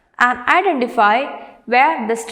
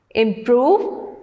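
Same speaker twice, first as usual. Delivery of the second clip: "improve" with the weight on PROVE; stress on second syllable. Shown as a demonstration. Teacher reading words to students, "improve" stressed on PROVE. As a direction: say "improve" as im-PROVE, rising at the end. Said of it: "stress on the second syllable".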